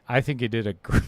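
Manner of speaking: breaking into laughter mid-word; cracking up, can't finish; laughing. Real, not acted. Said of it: "I couldn't finish it without laughing".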